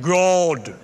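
booming, drawn-out churchy God; pompous prayer voice. Shown as a demonstration. Pastor mocking showy prayer, booming churchy voice. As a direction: overdo it like a showy pray-er. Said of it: "you pray like".

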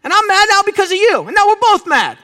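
high, loud acted grievance; mock mad. Husband blaming wife for his mood, acted annoyance. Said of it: "then I realize I'm mad".

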